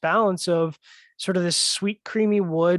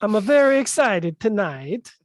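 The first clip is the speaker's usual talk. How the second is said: hammed-up Italian accent with a hint of Borat; bad Italian accent; 4 semitones higher, 3 dB louder than usual. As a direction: put on a botched Italian accent, pitched up. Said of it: "It's a cross between Italian and Borat".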